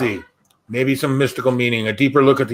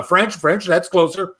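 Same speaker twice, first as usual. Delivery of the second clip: ordinary delivery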